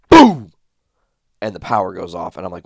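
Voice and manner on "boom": sudden loud, high boom; explosive shouted sound effect; imitated explosion. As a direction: burst it out like a blast. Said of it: "And then I hear".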